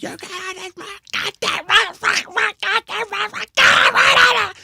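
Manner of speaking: very high, strained yelling drill sergeant; drill instructor yell. Retold or imitated. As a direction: bark it like a drill instructor losing it. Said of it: "his drill instructor voice".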